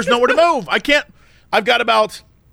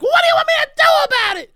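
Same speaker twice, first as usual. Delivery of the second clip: much higher, fast mock shout; annoyed shout; much faster than usual. Woman snapping at him in the lot, much higher, fast mock shout. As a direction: mimic her snapping back. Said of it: "she's like".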